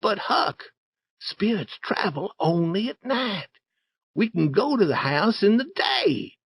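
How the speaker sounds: put-on very high-pitched young boy voice; persuading Tom. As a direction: coax a wary friend in a high voice. Story figure Tom persuades Huck.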